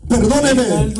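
normal talking voice

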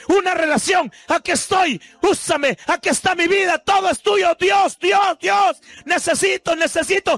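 impassioned pulpit shouting; fervent preaching. Acted. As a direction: preach it with fire to the congregation. Preacher at full force.

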